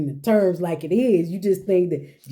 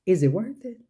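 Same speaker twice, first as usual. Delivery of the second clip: usual speaking voice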